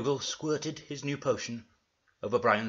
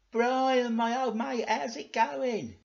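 high, chummy character greeting; matey and high-pitched; Dougal; 10 semitones higher, 4 dB louder, slower than usual. Acted. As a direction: hail an old mate in a raised voice. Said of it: "said Dougal".

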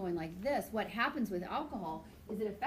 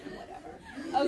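embarrassed whatever; 3 dB louder, slower than usual. Retold or imitated. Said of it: "I was like".

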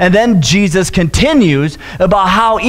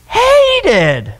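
"hated" squeaked out much higher, in a put-on voice, falling; squeaky exaggerated hated; 12 semitones higher than usual. Shown as a demonstration. Preacher milking the joke, squeaky high "hated". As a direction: yelp "hated" way up high as a comic overdo. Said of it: "And Jonah hated".

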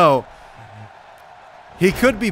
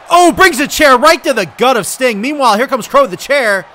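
raised, louder burst of commentary; excited play-by-play; 4 semitones higher, 6 dB louder than usual. Real, not acted. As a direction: exclaim at the hit and keep calling. Chair hit called live.

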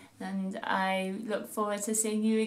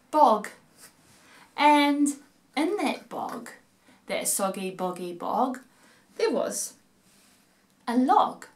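put-on lively sing-song reading to children; storytelling voice; 4 dB louder than usual. A narrator's reading. Narrator reading a rhyme to kids at home, playful storytelling voice. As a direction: read it like a bedtime rhyme for little ones.

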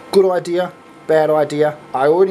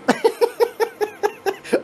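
long high-pitched real giggling fit; helpless laughing fit. Real, not acted. Losing track of the sums on camera, laughing helplessly. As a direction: lose it completely in high laughter. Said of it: "I'm sorry. I am so sorry. I've lost it".

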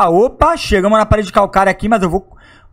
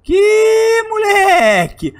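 slow, drawn-out high exclamation; disbelief; 13 semitones higher, much slower than usual. Real, not acted. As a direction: stretch it out in disbelief. Disbelief at failed mission. (exclamação lenta de incrédulo)

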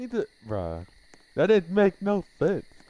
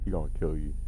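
ordinary talk

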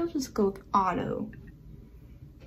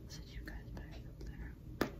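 soft unvoiced whisper; 18 dB quieter than usual. Real, not acted. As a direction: whisper it close to the mic. Whispering while moving camera.

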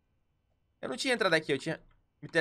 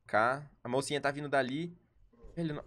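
ordinary delivery

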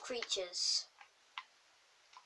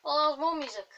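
ordinary talk, nothing marked

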